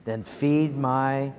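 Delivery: slow, measured reading aloud; scripture reading. Acted. Preacher reads the verse with congregation, slow scripture reading. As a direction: lead a room in reading, unhurried.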